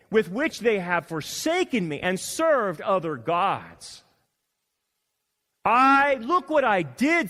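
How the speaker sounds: loud, slow, high preaching reading; preaching scripture. Acted. Preacher reading God's words to Samuel, booming and slow. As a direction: thunder God's words out slowly.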